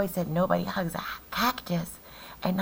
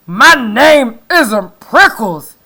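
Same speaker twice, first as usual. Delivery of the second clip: loud, slow grumpy yell; angry yell; 6 semitones higher, 19 dB louder, slower than usual. Acted. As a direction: shout back, offended. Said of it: "Hank yelled back".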